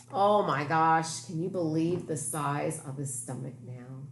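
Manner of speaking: usual speaking voice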